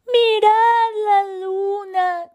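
high, loud, drawn-out mouse cry; joyful shout. Acted. Overjoyed mouse seeing the moon, high loud shout. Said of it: "gritó".

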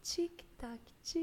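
breathy hushed whisper. Acted. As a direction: whisper it as a hint. Whispered hint in music quiz.

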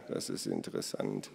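very low, soft rumble; grumbling murmur. A narrator's reading. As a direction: murmur it deep down to yourself. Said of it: "brummte er". (tief und leise gebrummt)

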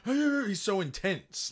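high breathless imitation of the nervous guy; mock intense gasp. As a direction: gasp and gush like an awkward nerd.

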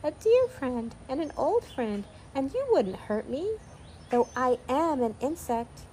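high, slowish tiny insect; little voice. A narrator's reading. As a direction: plead your friendship in a small high voice. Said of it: "the little voice went on".

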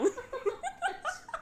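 squeaky breathy giggle; a short high giggle; high soft laugh. Real, not acted. Laughing at the vomit-girl joke, a high giggle.

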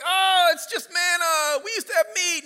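high, loud mock whining shout; whiny complaining crowd. Preacher voicing Israelites griping about manna, high loud mock whine. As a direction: sneer at the manna like spoiled kids. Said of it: "They're like".

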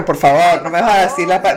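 "Por favor" dropped low, begging; pleading intonation. Retold or imitated. Explaining the Venezuelan tone of please, pleading low. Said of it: "también tiene la entonación".

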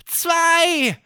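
hoch und laut gerufen; zähl laut mit hoher Fabelstimme; Barney zählt. Acted. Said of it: "Barney beginnt zu zählen". (Barney zählt laut hoch)

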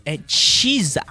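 Chisa said the Japanese way, chee-zah; Japanese accent Portuguese. Shown as a demonstration. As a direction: Chisa pronounced Japanese style, not Caesar. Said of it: "por causa do sotaque japonês".

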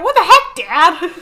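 Koopalings quote. Retold or imitated. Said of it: "'cause they're like".

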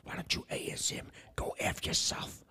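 sweetly whispered insult; hushed ASMR-style put-down; mock ASMR whisper. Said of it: "Here's an A S M R for you".

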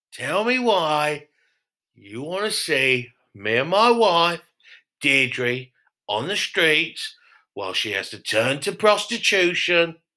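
slow drawn-out pleading rant; mock tragic plea; slow dramatic plea. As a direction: plead slowly like a soap opera.

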